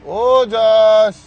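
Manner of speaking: a long drawn-out holler up to the building; long calling shout. Real, not acted. Calling Ojas down from the fourth floor, long drawn-out holler. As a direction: stretch the name out very slowly, high and loud.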